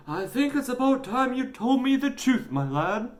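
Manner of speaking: high, strained parental demand; stern dad. A narrator's reading. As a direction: press the boy for the truth. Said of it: "only called Alfie when he had done something wrong".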